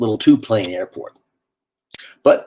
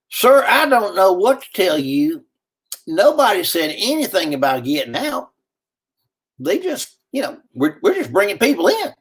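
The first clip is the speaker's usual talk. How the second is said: a hint of a harried soldier, higher; soldier's reply, lightly voiced; 9 semitones higher than usual. Soldier telling the stranded man he can't help, lightly voiced. Soldier answers narrator's question.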